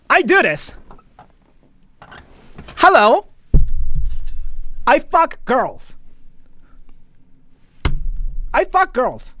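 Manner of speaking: loud, high, slow hammed-up bragging voice; over-the-top look-at-me voice; mock obnoxious announcement. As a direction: shout it out like a show-off nobody asked. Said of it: "you just go like".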